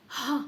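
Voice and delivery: breathy ह like an out-breath; the h phonic sound, just air. Shown as a demonstration. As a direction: push out a soft ह like breathing out. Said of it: "तो एच का sound क्या होता है?".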